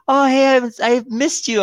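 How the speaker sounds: very high, put-on girlfriend voice; mock gushing ex. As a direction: imitate her gushing in falsetto. Imitating ex trying to return.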